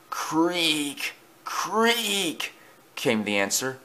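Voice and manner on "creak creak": high, thin, soft creak; creaking noise. A narrator's reading. Noise answers Thomas in the dark, soft high creak. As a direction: draw the word out like a quiet hinge.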